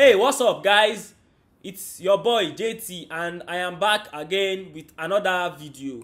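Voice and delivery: intro redone in a light African accent. Shown as a demonstration. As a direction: greet the viewers in a put-on African accent. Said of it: "let's use African accent for this video".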